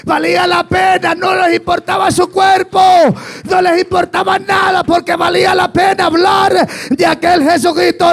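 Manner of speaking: high, loud shouted sermon; fervent high-pitched preacher shout; full-force preaching. Real, not acted. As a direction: cry it out to the congregation at full pitch.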